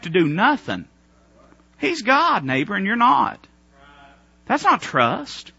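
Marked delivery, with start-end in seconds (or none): none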